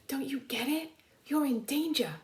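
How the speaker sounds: high, urgent yapping plea; urgent dog voice. Acted. The dog Stubby urges sleeping Conroy awake, high and urgent.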